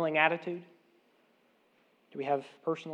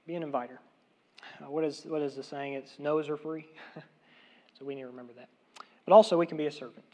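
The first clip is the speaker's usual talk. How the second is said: normal talking voice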